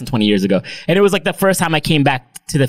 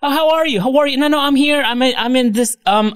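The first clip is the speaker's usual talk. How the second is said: higher, chirpy pretend call; hammed-up chat with his uncle; fake phone call; 12 semitones higher than usual. Pretending his uncle is calling, chirpy fake call. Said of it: "oh, hold on, I got a call".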